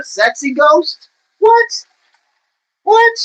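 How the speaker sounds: high-pitched comic disbelief; playful incredulous squeak; mock astonishment. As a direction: squeal the "what" in fake shock. Mock astonishment at his own joke.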